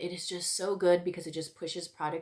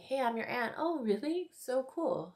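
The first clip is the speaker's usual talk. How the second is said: higher, softer mock chirpiness; mock casual aunt; 6 semitones higher, 6 dB quieter than usual. Lightly mocking the aunt's casual greeting and her own reply. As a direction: lightly parody the breezy tone.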